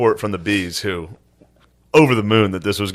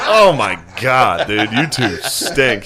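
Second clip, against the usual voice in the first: slow drawn-out quote; slower than usual. Retold or imitated. Slow, mocking the two comics to their faces. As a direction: stretch it out slowly, mock disgust. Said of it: "I was like".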